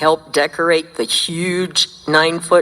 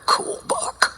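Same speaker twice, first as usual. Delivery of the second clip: a soft whispered echo of the title; hushed repeat; whispered aside; 6 dB quieter than usual. Real, not acted. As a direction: whisper it to yourself. Repeats title under breath.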